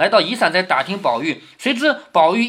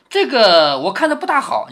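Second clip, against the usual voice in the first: higher-pitched, hesitant hedging; put-on reluctance, pitched up; teasing reluctance; 4 semitones higher, 3 dB louder than usual. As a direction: keep them dangling with feigned doubt. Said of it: "你看王熙凤要吊胃口了".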